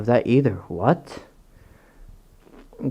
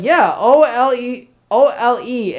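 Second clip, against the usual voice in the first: retold spelling-out; 10 semitones higher, 4 dB louder than usual. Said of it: "I was like".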